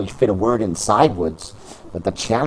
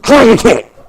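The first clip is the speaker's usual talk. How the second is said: high, loud shout of the move name; shouted move call; 13 dB louder, slower than usual. Acted. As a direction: bellow the finisher. Finisher called out.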